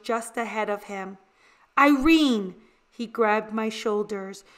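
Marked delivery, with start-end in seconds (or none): 1.8-2.5 calling out a name sharply, louder; urgent call. A narrator's reading. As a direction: call her name in alarm. Father calls out urgently.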